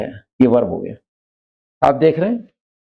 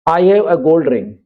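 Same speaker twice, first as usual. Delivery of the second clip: slow, spaced example sentence; slow classroom read; slow dictation; much slower than usual. Shown as a demonstration. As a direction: spell it out slowly for the class. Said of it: "एक ring अंगूठी होती है".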